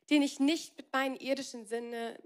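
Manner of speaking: ordinary talk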